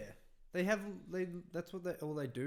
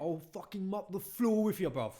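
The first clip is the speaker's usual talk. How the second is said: louder, higher mock London tough talk; English accent; 7 semitones higher, 8 dB louder than usual. Teasing his sister in a higher, louder English accent. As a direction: threaten the sister in London slang. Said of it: "I'm always like".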